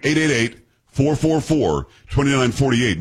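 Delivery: phone number read slowly, group by group; slow right down, pausing between number groups; deliberate, spaced-out digits for listeners to note. Real, not acted. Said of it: "that number again and slower in a second".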